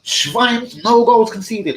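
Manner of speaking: high, loud hammed-up German shouting; over-the-top German accent; mock German accent. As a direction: yell like a furious German manager. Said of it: "I'm trying to do a German accent".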